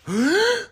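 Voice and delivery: high, sudden imitated gasp; sharp mock gasp. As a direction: gasp as if shocked at the screen.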